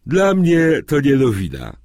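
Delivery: high, loud, mildly yawned reply; a touch of a yawn. A narrator's reading. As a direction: answer through a put-on yawn. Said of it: "odpowiedział ziewając".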